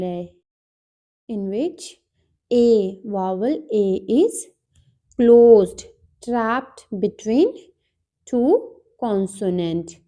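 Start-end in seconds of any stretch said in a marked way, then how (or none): 5.2-5.8 "closed" said much louder, hit hard; emphatic teacher stress. Shown as a demonstration. Teacher explaining closed syllables to students, stressing "closed" loudly. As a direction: punch "closed" so the class remembers it.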